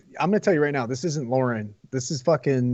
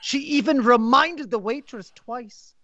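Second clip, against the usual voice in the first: higher, slow put-on reviewer voice; fake reviewer voice; 5 semitones higher, much slower than usual. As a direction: read it in a prim put-on voice. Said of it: "so you have to do a fake voice?".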